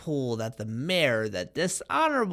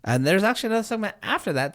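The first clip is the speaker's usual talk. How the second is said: normal talking voice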